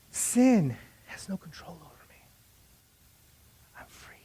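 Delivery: softening into a whisper; hushed declaration. Shown as a demonstration. Inner answer when temptation comes, hushed to a whisper.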